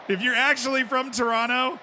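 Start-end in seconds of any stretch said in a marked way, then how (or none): 1.1-1.8 Toronto with the second T skipped; Toronto said as "Toronno"; local pronunciation. Shown as a demonstration. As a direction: skip the last T in Toronto. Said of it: "You just skip the T".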